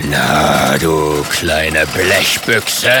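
tiefes, gedehntes Drohen eines Drachen; herrischer Drache. Acted. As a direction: fahr ihn tief und langsam an. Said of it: "herrscht Onkel Ingmar den Ritter an". (herrisch drohender, tiefer Drache)

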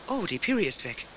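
ordinary delivery

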